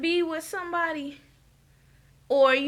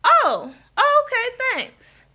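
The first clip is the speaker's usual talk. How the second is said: much higher, put-on voice of a flustered woman; imitating Candy; 10 semitones higher, 4 dB louder than usual. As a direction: mimic a caught-off-guard Candy, pitched way up. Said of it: "And she's like".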